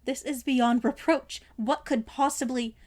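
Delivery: loud, slow, indignant outburst; anger climbing into his voice, slowed and loud; rising anger. Acted. Draco confronting the headmaster, loud and slow with anger. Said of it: "anger rising in his chest and voice".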